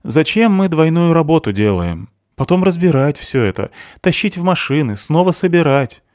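high-pitched aggrieved complaint; grumbling. A narrator's reading. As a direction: list each chore as a grievance. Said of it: "ворчал Кройчик". (ворчливо, на повышенном тоне)

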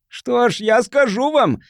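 high, drawn-out declaration; announcing. A narrator's reading. Bleeding man on the porch addressing the crowd, announcing. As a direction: announce it excitedly to everyone. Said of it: "объявил он".

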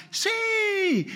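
put-on very high eager childlike shout; children shouting yes. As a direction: yell it like excited kids. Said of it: "los niños gritaban".

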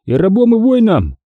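high-pitched, defiant brawler; cocky challenge, pitched well up; boastful Foma. A narrator's reading.

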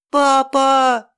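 put-on child wailing for his dad, high; high, loud sobbing cry of a child; snotty crying. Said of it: "Михаэль уже рыдал в голос".

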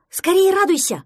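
high, loud duckling reply; excited call. A narrator's reading. Duckling answers his fallen friend, loud and eager. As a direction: call out brightly and urgently. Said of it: "откликнулся утёнок".

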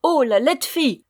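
high, loud mocking cry; sneering jeer. A narrator's reading. Third dwarf sneering at the rude girl, mocking. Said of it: "ricana un troisième".

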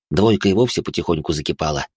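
usual speaking voice

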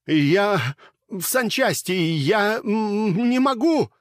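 high, loud, breathless panic; panicked high cries; choking with fear. A narrator's reading. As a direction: gasp it out in terror. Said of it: "захлёбывался от волнения и страха".

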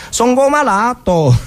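lightly voiced dialect whine; a hint of a whining young soldier; lazy recruit's excuse. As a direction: mimic the lazy lad's stock excuse. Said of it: "risponde inevitabilmente".

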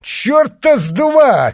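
loud, high, slow defiant shout. A narrator's reading. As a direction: hurl it across the room. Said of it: "крикнул кто-то".

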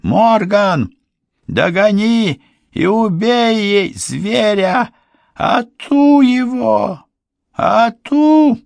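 высокий медленный вопль, нарастающий; натравливай, кричи всё громче; крик ведьмы. A narrator's reading. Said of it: "кричала вслед Полуину ведьма". (нарастающий злобный вопль ведьмы)

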